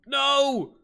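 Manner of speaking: high, loud cry of dismay; dismayed shout. Real, not acted. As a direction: yell it at the loss. Game mishap.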